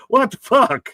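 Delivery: high, loud laugh into what the fuck; cracking up at the chat; laughing disbelief. Real, not acted. Streamer laughing at a viewer's odd question.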